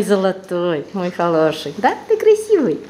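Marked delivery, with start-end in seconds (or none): 1.8-2.8 high squeaky pet talk. Real, not acted. Cooing over her pet.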